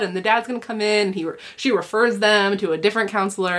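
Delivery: normal talking voice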